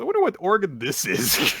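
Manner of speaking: high, loud, innocently curious; curious Momo voice. Retold or imitated. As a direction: wonder aloud like a curious kid. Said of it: "And Momo goes".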